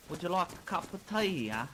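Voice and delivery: posh British impression. Friend playing a posh Briton offering tea. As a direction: offer tea like a prim Brit. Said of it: "best British impressions".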